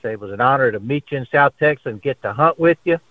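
usual speaking voice

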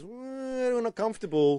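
high, slow mock official; mock regulator voice. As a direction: voice a stuffy regulator, slowly.

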